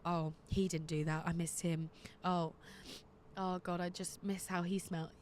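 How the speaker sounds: low, softened pining voice; mild mock heartbreak, sighed to oneself; wistful longing. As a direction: quietly voice a lovesick inner thought. Voices own longing thoughts after breakup.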